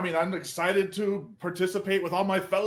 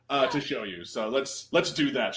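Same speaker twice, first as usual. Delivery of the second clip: ordinary talk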